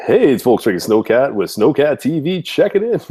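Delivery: high, put-on channel intro voice; mock announcer intro. Shown as a demonstration. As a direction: belt out the show opener in a goofy announcer voice. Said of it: "It's supposed to go".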